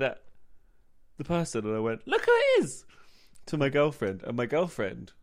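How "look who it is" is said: high, loud excited call of look who it is. Retold or imitated. To his girlfriend on the stairs, excitedly calling out. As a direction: point and call out in delight.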